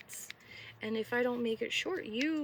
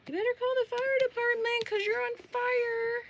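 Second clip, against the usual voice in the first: mock yell; 12 semitones higher, 8 dB louder than usual. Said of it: "I'm like".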